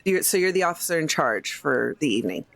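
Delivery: usual speaking voice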